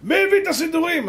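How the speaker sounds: high, loud acted SS shout; shouted threat of a question; angry interrogation. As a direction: demand the culprit at full voice. SS man repeats his demand.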